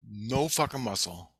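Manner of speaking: ordinary talk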